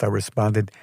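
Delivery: ordinary delivery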